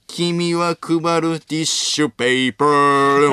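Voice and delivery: slow, over-pronounced ティッシュペーパー; Wagyu pronunciation bit. Retold or imitated. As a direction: stretch ティッシュペーパー out with a showy mock-English sound. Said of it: "和牛さんのこのネタで珍しいな。発音よってやつね".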